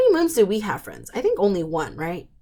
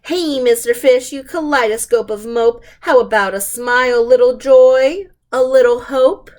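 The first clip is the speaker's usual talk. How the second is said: pushy squid voice; 3 semitones higher, 7 dB louder than usual. Acted.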